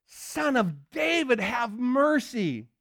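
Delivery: slow, high-pitched plea; crying out. Acted. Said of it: "he cries out even more".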